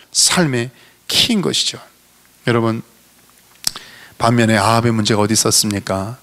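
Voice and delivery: ordinary delivery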